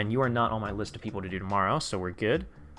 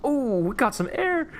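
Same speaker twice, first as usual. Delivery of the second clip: thrilled ooh; 14 semitones higher, 3 dB louder, slower than usual. Real, not acted. Tank jumps on suspension test.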